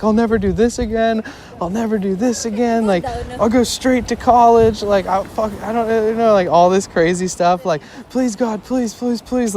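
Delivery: high, pleading, confessional mock prayer; imitating desperate praying. Friend praying during the car chase, high mock pleading. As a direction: beg God like a terrified teen. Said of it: "venting out all his sins".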